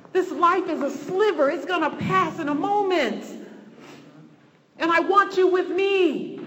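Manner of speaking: high, urgent voice of God; impassioned preaching. Retold or imitated. Preacher voicing God calling believers to eternity, impassioned. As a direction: preach it high and insistent, building to the end.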